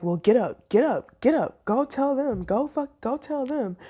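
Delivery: mock yell, high and loud; retold yelling. Manager yelling orders at a waitress, mock yell. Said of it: "this man yells at me".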